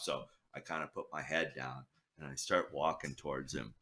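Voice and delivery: ordinary talk, nothing marked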